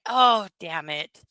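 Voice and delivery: ordinary delivery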